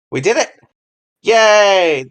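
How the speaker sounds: high, loud "yay" of triumph; celebratory cheer. Real, not acted. Host celebrating covering the topic by accident, celebratory cheer. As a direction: cheer a small win out loud.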